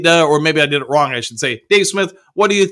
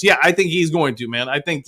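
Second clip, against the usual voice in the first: usual speaking voice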